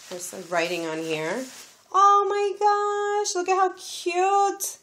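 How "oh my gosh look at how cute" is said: high, slow gushing oh my gosh; delighted high gush; excited squeal. Real, not acted. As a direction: squeal over something cute. Opening a gift from a friend.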